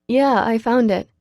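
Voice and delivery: ordinary delivery, nothing marked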